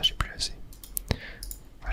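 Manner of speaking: ordinary delivery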